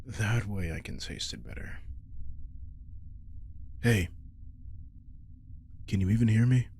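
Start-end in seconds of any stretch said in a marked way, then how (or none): 3.8-6.7 calling out. Acted. Calling to tranced familiar.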